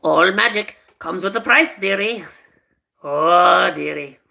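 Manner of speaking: a lightly voiced sly imp; mild attempt at the storybook imp; Rumpelstiltskin impression. Shown as a demonstration. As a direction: purr the dearie like a tricky deal-maker. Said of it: "I have to do the Rumpelstiltskin impression".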